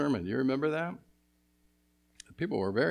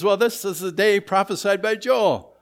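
Peter's announcement voiced higher and louder; Peter proclaiming; 8 semitones higher, 10 dB louder than usual. Preacher voicing Peter to the crowd, proclaiming loudly. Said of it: "And he says".

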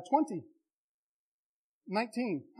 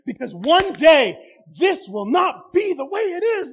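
louder triumphant preaching; preaching proclamation; 3 semitones higher, 6 dB louder than usual. Acted. Preacher assuring brothers and sisters, at full force. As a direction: proclaim the promise over the room.

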